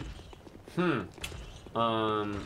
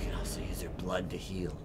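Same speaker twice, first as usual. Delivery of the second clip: whispering; 5 semitones lower, 4 dB quieter than usual. Real, not acted. Quiet game advice.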